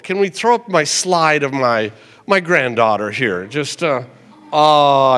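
ordinary talk

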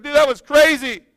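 frantic guy. Retold or imitated. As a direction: blurt it like a shaken man just out of the building. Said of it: "He's like".

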